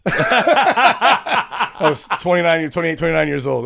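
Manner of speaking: real laughter breaking into the line; laughing. Real, not acted. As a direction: laugh it out while telling your age. Laughing at own marriage story.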